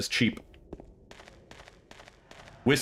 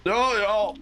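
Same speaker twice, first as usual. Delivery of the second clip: high excited exclamation; sudden surprise; 10 semitones higher than usual. Real, not acted. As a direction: burst out as it hits you. Suddenly remembers something.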